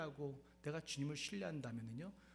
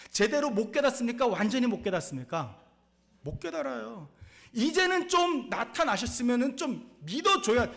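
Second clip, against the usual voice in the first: much louder, higher preaching shout; shouted question; 9 semitones higher, 12 dB louder than usual. Acted. Preacher to congregation, a loud high shout. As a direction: hammer the question home.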